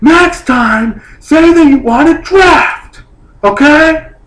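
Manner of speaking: very high, slow, loud mocking whine; condescending bartender. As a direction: drag it out like a smug bartender talking down. Bartender lecturing him, mocked.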